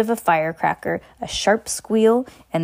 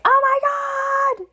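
high, slow, drawn-out squeal; mother acts out her daughter's thrilled freak-out; much slower than usual. Pretend. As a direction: gush it like an eleven-year-old who just learned she's a witch. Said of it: "freak out and be like".

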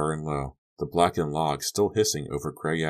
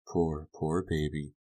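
slow, soft, far-away cooing; soft, drawn-out pity; distant soothing; 5 dB quieter, slower than usual. A narrator's reading. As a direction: soothe slowly, as if from miles away. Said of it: "But she sounded miles away".

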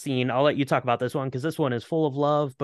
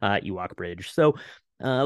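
usual speaking voice

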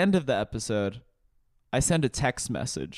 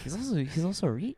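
high, soft, swooping put-on voice; Connor O'Malley; 5 semitones higher, 7 dB quieter, faster than usual. An imagined viewer marvelling at his wealth, Connor O'Malley voice. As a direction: marvel in a hushed, rising lilt. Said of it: "I did a Connor O'Malley voice".

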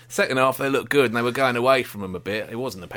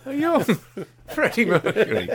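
laughing; 12 semitones higher, 4 dB louder than usual. Real, not acted. Teasing friend, laughing.

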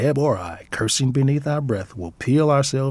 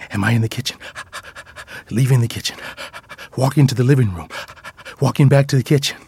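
panting between short, louder phrases; eager dog's voice; 2 semitones higher, 4 dB louder, much faster than usual. Acted. Dog tracking its owner room to room, panting eagerly.

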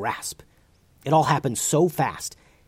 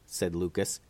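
usual speaking voice

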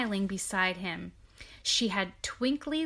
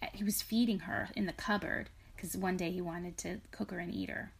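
whispering; 5 dB quieter than usual. Real, not acted. Whispered aside to child.

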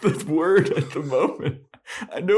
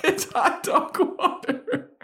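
laughing; slower than usual. Real, not acted. Laughing repeat of phrase.

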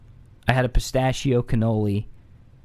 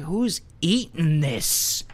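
disgusted; 4 semitones higher, much slower than usual. Retold or imitated. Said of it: "I'm like".

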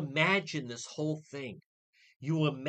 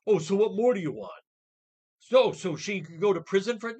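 mocking quote; 4 semitones higher, 9 dB louder than usual. Host mocks critics' reasoning.

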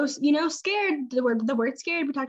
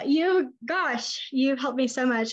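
ordinary delivery

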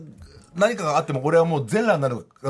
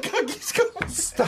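high, laughing repeat of the joke; laughing echo; 13 semitones higher, 3 dB quieter, slower than usual. Real, not acted. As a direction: parrot it back through laughter. Echoing host's joke, laughing. (笑いながら高い声で繰り返す)

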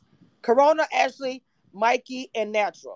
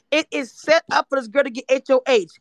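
ordinary delivery

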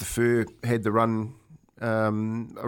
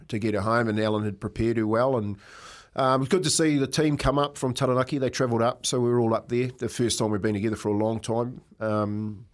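normal talking voice

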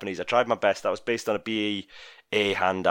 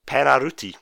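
Penaruti said louder in a mock Japanese accent; 5 semitones higher, 8 dB louder than usual. Shown as a demonstration. Quiz host reading the next term to the panel, in a loud mock Japanese accent. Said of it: "my best Japanese accent".

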